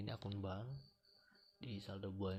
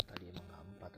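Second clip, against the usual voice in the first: drowsy, nodding off while talking; sleepy. Real, not acted. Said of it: "aduh, sorry, ngantuk nih".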